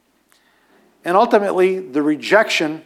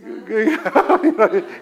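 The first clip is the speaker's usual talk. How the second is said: laugh; 2 semitones higher than usual. Real, not acted. Laugh at Abraham joke.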